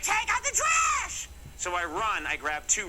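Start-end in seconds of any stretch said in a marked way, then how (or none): 0.0-1.3 very high, drawn-out mock-mom holler; mom yelling downstairs. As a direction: holler it like a mother calling down the stairs. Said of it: "My mom yells down the stairs".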